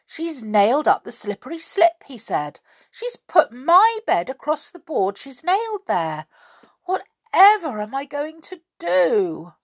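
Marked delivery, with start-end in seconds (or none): 0.0-2.0 higher, wailing complaint; storybook lament, pitched up; groaning dismay. A narrator's reading. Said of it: "He groaned". 2.9-9.5 high, despairing wail; swooping storybook lament; groaning dismay. A narrator's reading. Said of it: "He groaned".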